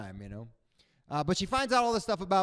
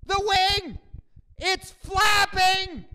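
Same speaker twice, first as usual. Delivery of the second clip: much higher and louder, a slow panicked yell; way too loud; 9 dB louder, much slower than usual. Retold or imitated. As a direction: shriek it across the cabin in panic. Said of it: "in way too loud a voice goes".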